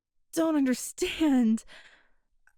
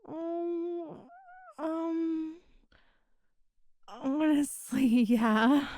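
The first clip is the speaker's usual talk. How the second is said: touched, near tears; 3 semitones higher, much slower than usual. Acted. Touched by the offer.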